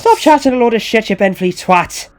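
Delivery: put-on loud, high, angry Irish accent; thick Irish accent. Irish organiser telling Ronnie off, angry thick Irish accent. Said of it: "in her thick Irish accent".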